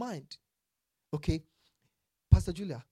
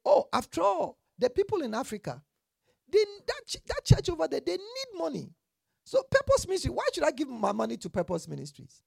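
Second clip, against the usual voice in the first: much higher and louder, incredulous excuse; mock self-justifying giver; 10 semitones higher, 7 dB louder than usual. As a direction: ham up a giver who sends money elsewhere. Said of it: "for me to say".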